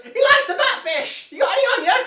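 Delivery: put-on high, cracked old man squeak. GM voicing the old lake hermit, high old man voice. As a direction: play the naked old hermit, high and quavery. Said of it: "And he goes".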